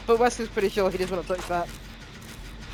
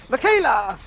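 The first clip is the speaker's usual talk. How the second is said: narrated shout; 3 semitones higher, 6 dB louder than usual. A narrator's reading. Narrator voices a character calling her friend, mock shout. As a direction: call the name out as a character's shout. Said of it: "she shouted".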